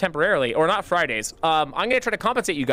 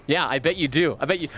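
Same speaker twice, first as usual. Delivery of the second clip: ordinary delivery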